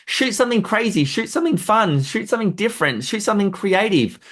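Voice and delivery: rally the team to get creative; pumped-up urging. Acted. Urging photographers to shoot creatively.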